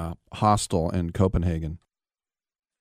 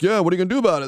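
much higher, goading challenge; mock taunting comeback; 11 semitones higher, much faster than usual. The twelve kids daring the storyteller, higher mock-taunting voice. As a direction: imitate a gang daring him to act. Imagined gang taunts him back.

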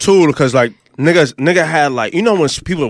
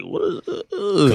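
soft, drawn-out, higher-pitched stammer; scared; 6 semitones higher, 5 dB quieter, much slower than usual. Retold or imitated. Recalling being scared in Harlem, scared and drawn out. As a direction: act out a mildly scared, stretched-out reaction. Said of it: "I was scared".

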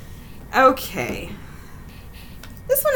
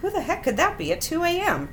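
higher-pitched, puzzled question; bewildered; 6 semitones higher than usual. A narrator's reading. Brent startled by late-night banging at the door, bewildered. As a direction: ask it in bewilderment. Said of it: "Brent asked, bewildered".

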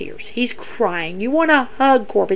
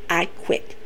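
"I quit" breathed out voiceless and much softer; whispered I quit; 6 semitones lower, 12 dB quieter than usual. Retold or imitated. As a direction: let "I quit" fall away in a whisper. Retelling the I Quit stipulation.

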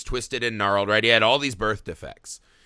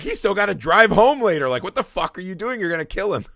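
retold exasperated scolding, higher pitched; half-serious alarm at the bartender; retold exasperation; 5 semitones higher than usual.

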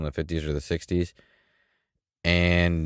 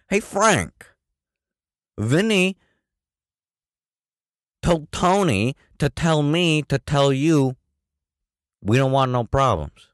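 mobster impression; 8 semitones higher than usual. Comedian mocking The Irishman dialogue in a mobster voice. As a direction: put on a mafia movie voice. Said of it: "That was the whole movie".

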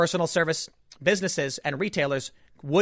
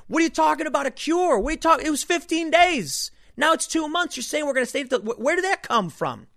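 Dave Portnoy rant; 11 semitones higher than usual. Retold or imitated. Host imitating Portnoy's viral rant about the lockdown, exasperated high yell. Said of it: "excellent rant".

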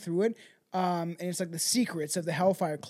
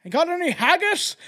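put-on much higher, louder jokey Scottish accent; mock Scottish accent; 10 semitones higher, 7 dB louder than usual. Scottish ghost bellman asking guests, hammed-up Scottish accent. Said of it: "my Scottish accent".

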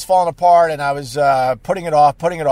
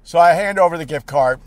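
ordinary talk, nothing marked

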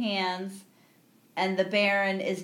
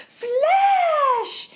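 high, loud mock call across a room; calling out Flash; 7 dB louder than usual. Retelling the movie, Dale calls out to Flash, high loud call. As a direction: shout the name high and loud, like spotting him. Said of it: "she turns the corner and she's like".

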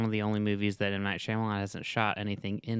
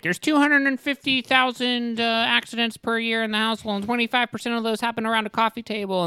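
dad reciting facts; 13 semitones higher, 5 dB louder than usual. Retold or imitated. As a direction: parody a dad lecturing about household stats. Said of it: "and he's like".

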